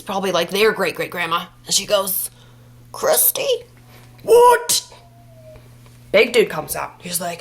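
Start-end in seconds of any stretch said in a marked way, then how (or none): 2.9-3.6 put-on very high, loud old-woman call; ancient old lady. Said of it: "she's, like, a hundred years old". 4.2-4.9 very high, loud mock shriek; shocked outburst. Shocked to be called by her.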